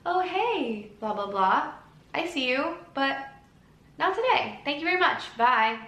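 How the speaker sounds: high, sing-song polite brush-off; breezy dismissal. Acted. Talking back to the inner voice, breezy dismissal.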